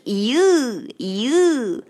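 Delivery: "ew" drawn out like reacting to something gross, then "you"; disgusted ew sound. Shown as a demonstration. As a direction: slide from "ew" into "you". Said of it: "你们在看到很恶心的东西，会说".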